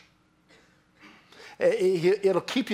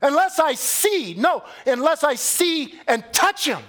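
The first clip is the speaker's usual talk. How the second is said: much higher and louder, stubborn insistence; emphatic demand; 13 semitones higher, 6 dB louder than usual. Acted. Thomas insisting to the disciples he must see, emphatic loud demand. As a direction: plant your feet and insist loudly. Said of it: "Emphatic response".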